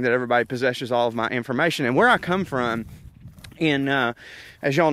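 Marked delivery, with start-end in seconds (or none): none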